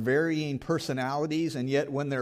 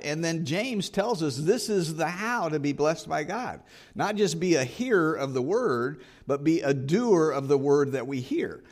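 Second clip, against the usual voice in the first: higher and louder, driving the point home; preaching at full force; 4 semitones higher, 4 dB louder than usual. Acted. Preacher urging the congregation to act, at full force. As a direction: press the congregation hard, rising with conviction.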